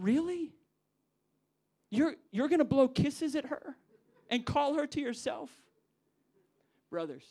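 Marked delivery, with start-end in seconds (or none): 0.0-5.5 loud, high, wounded disbelief; the groom can't believe it; hurt groom. Retold or imitated. Groom to the best man stealing his bride, wounded and loud. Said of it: "imagine the heart of the groom".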